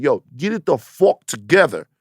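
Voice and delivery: a hammed-up gangster, drunk with power; Ben Lindbergh the loan shark. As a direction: squeeze him for the vig, slowly. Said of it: "He's like".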